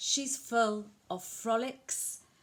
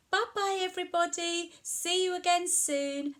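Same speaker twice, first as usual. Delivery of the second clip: higher, fast chirpy goodbye; cheery farewell; 9 semitones higher, faster than usual. Acted. Saying goodbye to the children, higher and cheery.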